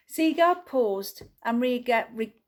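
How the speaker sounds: ordinary delivery, nothing marked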